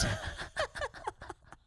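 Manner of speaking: breathless, high wheezing laugh; real fit of laughter. Real, not acted. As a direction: crack up helplessly at your own story. Cracks up at own drunk story.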